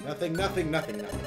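usual speaking voice

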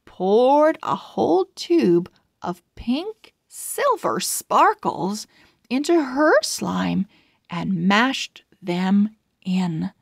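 ordinary delivery, nothing marked

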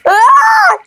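sudden high shriek; very high startled cry. Real, not acted. Monster jumps at him.

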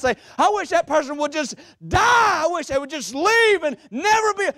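high, driving pulpit cadence; fervent preaching. Real, not acted. Preacher urging congregation to confront the past, fervent and high. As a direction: build in urgent repeated phrases.

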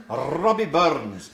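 Robbie Burns with a rolled R, loud and slow; Scottish accent. Shown as a demonstration. Teacher showing the class a Scottish R. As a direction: roll the R in Robbie like a Scot. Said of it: "you're impressed, aren't you".

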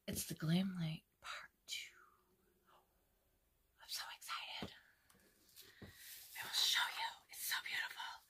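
hushed, breathy whisper of delight; excited whisper. Real, not acted. Whispering to viewers about the chosen palette, excited whisper. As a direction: whisper it like a thrilling secret.

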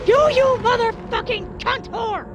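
real rage burst at an enemy; furious outburst. Real, not acted. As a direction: scream the insult after the jump scare. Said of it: "I'm officially angry".

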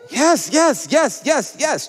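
high, punched repeated yeses; excited cheering. Real, not acted. Host urging the crowd to cheer for everything, excited high chant. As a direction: egg the crowd on with each word.

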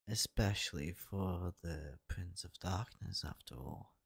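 normal talking voice

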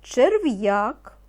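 teacher dictating single words, slow and distinct; slow dictation. Acted. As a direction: give each word slowly so children can write. (повільний диктант учителя)

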